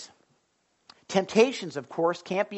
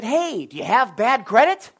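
loud, higher, hyped-up ad announcer; car commercial pitch; 6 semitones higher, 9 dB louder than usual. Retold or imitated. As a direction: sell it like a pushy radio car ad. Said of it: "it was a car commercial, and it said".

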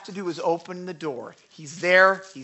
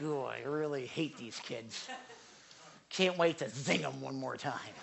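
mock mean thought; 7 semitones lower, 12 dB quieter than usual. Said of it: "it's not because I think".

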